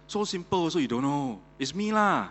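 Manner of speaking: acted smug, high-pitched retort; cocky answer. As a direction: answer as if it were obvious. Robin scoffs at easy riddle.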